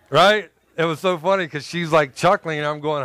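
ordinary talk

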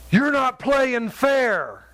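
slow, high-pitched mock whine of complaint; retold offended protest. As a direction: imitate an affronted man, stretching each word. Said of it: "he was so offended".

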